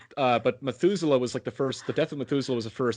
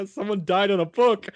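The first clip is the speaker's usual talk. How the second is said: mock distraught; 10 semitones higher, 4 dB louder than usual. Retold upset answer to mother.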